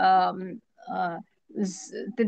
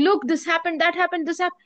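mock flustered mumbling, pitched up; panicked mumbling; 6 semitones higher than usual. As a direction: babble it like someone arriving with news, lightly acted. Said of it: "coming panting to you. He'll go on mumbling".

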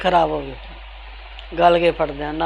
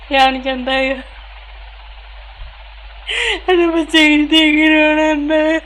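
real crying while speaking; 8 semitones higher than usual. Real, not acted. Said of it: "mummy ਨਾ ਰੋ।".

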